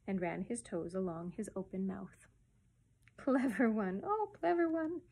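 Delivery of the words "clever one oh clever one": doting coo, rising high on the repeat; admiring. A narrator's reading. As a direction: praise a baby fondly. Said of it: "said his mother admiring".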